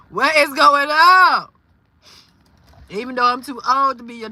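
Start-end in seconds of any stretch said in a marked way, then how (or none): 0.1-1.5 loud, drawn-out, higher exclamation; alarmed disbelief. Real, not acted. Reacting to Miss Cynthia's troubles, alarmed disbelief. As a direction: demand it slowly and loudly, baffled.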